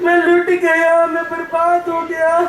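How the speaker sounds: hammed-up sobbing lament; wailing. Robbed trader bemoaning his stolen goods, wailing. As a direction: cry out and sob over a great loss. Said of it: "क्यों चिल्ला रहे हो".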